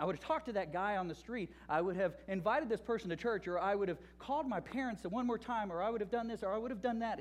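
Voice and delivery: preacher voicing a frantic list of things he'd change, building to a cry; building regret list. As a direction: pile the regrets up, climbing in pitch. Imagined regrets of a dying man.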